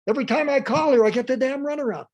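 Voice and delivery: high, loud outburst; angry customer. Acted. Customer complaining about the runaround, high angry rant. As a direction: rant as a fed-up caller. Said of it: "people get madder".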